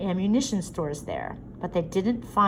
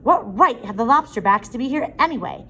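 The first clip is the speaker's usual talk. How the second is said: rising, louder indignant son; losing his temper; 5 semitones higher, 7 dB louder than usual. A narrator's reading. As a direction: flare up defending yourself. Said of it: "Sam was beginning to lose his temper".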